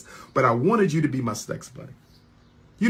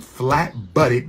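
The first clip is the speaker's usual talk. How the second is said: normal talking voice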